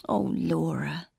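soft, slow, sorrowful sigh of words; tender sympathy. Acted. Marion puts her arms around Laura, tender and sorry. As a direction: comfort her gently, drawn out. Comforting her unhappy sister.

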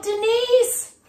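high, loud call for help; stuck girl yelling for her friend. Acted. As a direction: shout across to the neighbour. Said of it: "She yelled".

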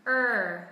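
Er with lips pushed forward and tongue curled; American Er with rounded lips; r-colored er. Shown as a demonstration. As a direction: curl the tongue back for Er. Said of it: "khẩu hình".

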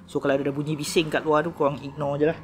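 ordinary talk

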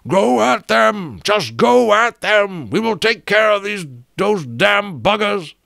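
very high, slow bellowed orders; captain bellowing to fellow aviators mid-battle. A narrator's reading. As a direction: bellow it through a speaking trumpet. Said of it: "bellowing through his speaking trumpet".

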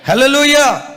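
loud, high hallelujah call; ringing preacher's hallelujah; shouted hallelujah. Real, not acted. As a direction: rouse the church with it. Preacher calls out to congregation.

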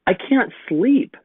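put-on high, slow child's plea; a small boy who can't sleep; little boy voice. A narrator's reading. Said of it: "he told his big sister".

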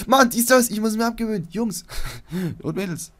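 loud, high self-scolding; mock exasperation. Player catches himself repeating his catchphrase, loud mock exasperation. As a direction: wail in comic frustration at your own habit.

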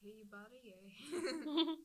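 put-on Canadian rising into "eh"; hammy fake Canadian; terrible Canadian accent. Goofing with friends, terrible Canadian accent. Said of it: "terrible Canadian accent".

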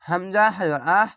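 ordinary talk

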